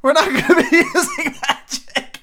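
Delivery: breaking into laughter mid-line, high and giggly; can't get through it without cracking up; laughing. Real, not acted.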